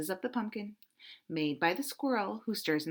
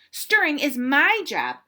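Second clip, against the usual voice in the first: loud, high and demanding, slowing at the end; angry squirrel; 7 semitones higher, 10 dB louder, slower than usual. Acted. Squirrel shouts at Duck to return the spoon. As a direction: stamp your foot and demand it back.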